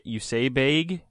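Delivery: bag echoed with the ay vowel, like payg, rising in disbelief; imitating Canadian bag. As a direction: mimic her Canadian bag.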